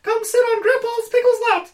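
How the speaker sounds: very high, fast squeaky cartoon mouse; cartoon mouse voice, laughing through it; Mickey Mouse voice. Shown as a demonstration. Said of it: "I can do Mickey Mouse".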